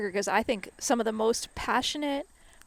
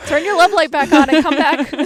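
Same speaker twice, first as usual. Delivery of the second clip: loud, higher, playful plea; hammed-up begging at full volume; mock pleading demand; 5 semitones higher, 11 dB louder than usual. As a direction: call someone back with comic desperation. Acts out plea to friend.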